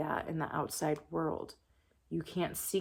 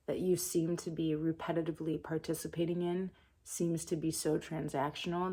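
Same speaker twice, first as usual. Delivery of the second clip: ordinary talk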